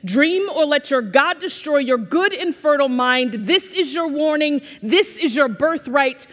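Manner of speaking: high, forceful spoken-word delivery; poem recitation. Retold or imitated. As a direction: declaim it like a slam poet's urgent warning. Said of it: "says this poem that changed the course".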